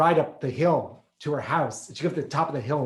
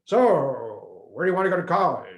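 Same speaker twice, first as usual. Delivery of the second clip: mock snob, louder and pushy; imitating his status-minded parents; snobby parents; 4 dB louder than usual. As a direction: quiz him like pushy parents. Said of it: "it was always like".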